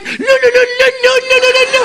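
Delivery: high trilled lark song; high birdlike "le le le"; lark imitation. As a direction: chirp it like a lark. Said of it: "je fais l'alouette comme ça".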